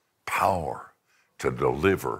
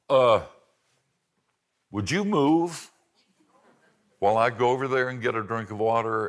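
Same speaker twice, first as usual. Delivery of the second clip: lightly voiced little girl, higher and polite; little girl asking; 6 semitones higher, 3 dB louder, faster than usual. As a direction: ask the lion timidly like a small girl. Girl asks lion for water.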